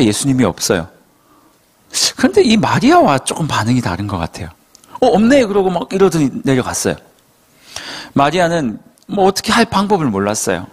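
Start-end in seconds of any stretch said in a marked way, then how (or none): 5.0-5.4 casual shrug. Retold or imitated. Disciples shrug at empty tomb.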